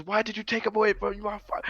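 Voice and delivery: high-pitched imitation of a singer's scream; imitated scream. Recalling an alternate mix to bandmate fans, imitated scream. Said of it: "that scream was".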